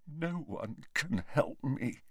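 soft, slow, hopeless voice; hushed, drawn-out misery; tearful despair. A narrator's reading. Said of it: "was crying".

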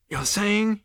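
high-pitched incredulous query. Acted. Zaphod questions the waiter's claim, incredulous. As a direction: check it in disbelief.

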